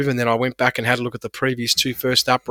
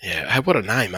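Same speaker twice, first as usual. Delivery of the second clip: ordinary talk, nothing marked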